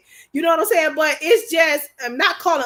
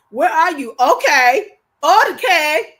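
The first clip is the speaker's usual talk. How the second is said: higher, louder pushy husband; mock impatient husband; 4 semitones higher, 7 dB louder, slower than usual. Wife mimicking husband demanding where she is, higher, louder. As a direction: play him demanding an answer. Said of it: "He gets like that".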